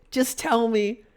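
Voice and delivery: high-pitched, insistent appeal; frustrated but held in; exasperated plea. Retold or imitated. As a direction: beg them to come out with it. Exasperated plea to absent patient.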